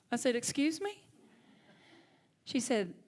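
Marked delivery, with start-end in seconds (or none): 0.3-1.0 higher, rising mock-indignant question; taken aback. Minister replaying her reply to her mentor's accusation, taken aback. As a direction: act out being caught off guard.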